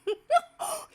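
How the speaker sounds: high, loud laugh that breaks into a sob; overwhelmed laugh-cry; real tears of joy. Real, not acted. Visitors arrive, overwhelmed.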